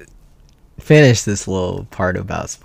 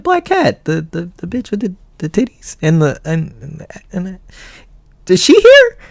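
high excited goofy voice; 8 semitones higher than usual. Retold or imitated. Friend voiced in retold story.

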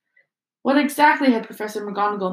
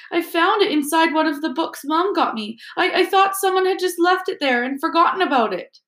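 much higher, stammering tearful explaining; tearful girl; 9 semitones higher than usual. Acted. Ginny explaining where the diary came from, tearful. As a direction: plead through tears. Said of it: "sobbed Ginny".

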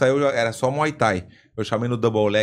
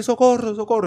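high-pitched Spanish cry for help; Spanish accent; 10 semitones higher, 3 dB louder than usual. Retold or imitated. Spanish gym partner calls for help under punches, mock Spanish-accented wail. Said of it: "porque o espanhol é "socorro".

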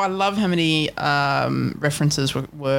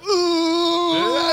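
high gibberish ooh; 13 semitones higher than usual. Pretend. Imitating a gibberish mashup intro.

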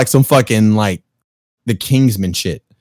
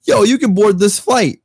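excited; 10 semitones higher than usual. Retold or imitated. Retold agent's good news.

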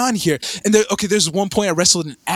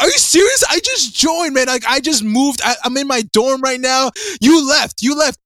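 incredulous outburst; 6 semitones higher than usual. Retold or imitated. Telling Ty he left right after signing up, incredulous and high. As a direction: complain high-pitched like you've been abandoned. Retold outrage at friend leaving.

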